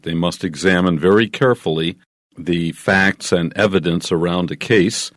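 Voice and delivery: normal talking voice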